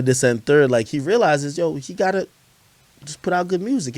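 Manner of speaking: ordinary talk, nothing marked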